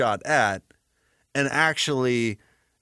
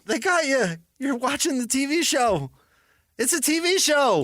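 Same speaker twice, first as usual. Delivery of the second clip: taunting; 10 semitones higher than usual. Acted. Taunting, higher pitch.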